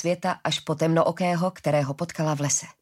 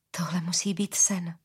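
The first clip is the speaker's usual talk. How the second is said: hushed, slow disbelief; whispered thought; 4 dB quieter than usual. Acted. Daughter whispering in disbelief before her father. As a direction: breathe it like a dream. Arila's stunned inner thought.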